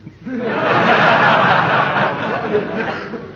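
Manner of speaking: long amused chuckle; hearty laugh. Acted. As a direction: laugh at the nephew's joke. Uncle laughs at Jack Benny joke.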